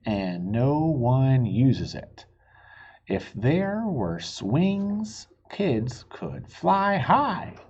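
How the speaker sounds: slow, earnest letter reading, pleading at the end; reading the kids' letter. A narrator's reading. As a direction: read it slowly like a child's plea. Reads kids' letter aloud.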